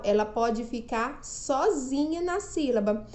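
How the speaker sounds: usual speaking voice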